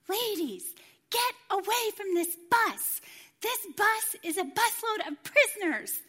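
high, loud reenacted warning; imitated yelling. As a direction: act out a driver yelling people away. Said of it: "and he yells at us".

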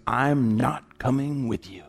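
lightly voiced stubborn kid, slow; defiant little boy. Little boy refusing to follow his mom, mildly mocked defiance. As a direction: drag the refusal out like a balking child. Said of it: "And the little boy goes".

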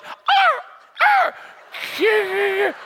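high far-off jungle call; hammed-up rainforest animal cry; mock jungle cry. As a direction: sound like the rainforest. Imitating rainforest sounds while asleep.

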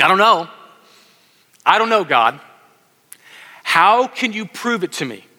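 a hint of a doubting old priest; doubting Zechariah. Preacher voicing Zechariah's doubt, mildly skeptical. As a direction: question the angel, unsure and wary. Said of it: "That's what he basically saying".

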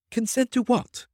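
high-pitched, baffled query; bewildered newcomer's high voice; puzzled question. A narrator's reading. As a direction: ask it in surprise, pitch right up.

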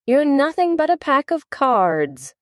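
high-pitched, scornful defiance; indignant storybook exclamation; defiant outburst. A narrator's reading. Alice defies the queen and court.

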